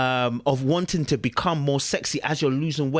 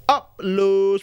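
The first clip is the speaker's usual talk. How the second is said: loud, higher-pitched drawn-out call; called-out sign-off; 5 semitones higher, 5 dB louder than usual. Real, not acted. Vlogger ending the video for subscribers, called out loud. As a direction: belt out the catchphrase.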